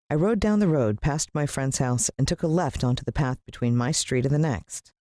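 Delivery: ordinary delivery, nothing marked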